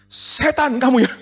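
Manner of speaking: high, loud, drawn-out shout imitating an angry caller; imitated outburst, loud and high-pitched; snapped yell. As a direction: bellow the insult like a furious caller.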